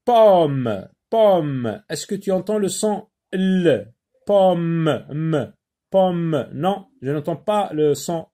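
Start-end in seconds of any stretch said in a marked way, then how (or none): 0.1-1.8 "Pomme" stretched with a falling tune and held m; drawn-out model word. Shown as a demonstration. Teacher testing pupils for the sound L, drawn-out word. 4.3-6.5 "Pomme" with the final m held and isolated; drawn-out final sound. Shown as a demonstration. Teacher checking for the sound L, drawn-out word.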